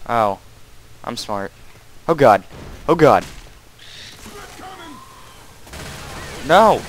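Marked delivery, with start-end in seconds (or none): 2.1-6.8 loud panicked cries rising to a high no; alarmed. Real, not acted. Gamer overrun by zombies, loud panicked cries. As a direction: panic as the horde hits.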